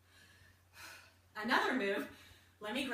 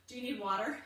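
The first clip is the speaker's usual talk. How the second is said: ordinary talk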